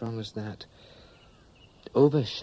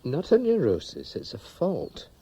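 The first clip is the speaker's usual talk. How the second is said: higher-pitched, emphatic objection; insistent protest; 9 semitones higher, 4 dB louder than usual. Acted. Pilot rejecting his friend's diagnosis of neurosis, insistent. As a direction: push back firmly, pitch jumping up.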